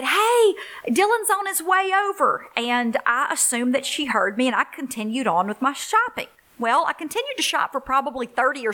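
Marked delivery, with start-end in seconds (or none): 0.0-2.5 high-pitched mock holler across a store; retold call-out. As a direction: call over to your sister from afar, much higher. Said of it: "I screamed across and said".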